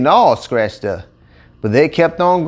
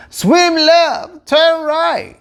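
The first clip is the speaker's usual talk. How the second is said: high called-out directions; hollered; 12 semitones higher, much slower than usual. A narrator's reading. Nicolette shouts directions to the gators, high and raised. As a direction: call back to the others, pitched up high. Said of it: "hollered at the others".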